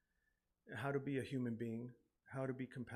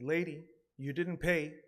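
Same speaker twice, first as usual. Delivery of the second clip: calls out louder, playing the strict bus driver; mock stern driver; 4 semitones higher, 9 dB louder than usual. Driver stops mother at fare box.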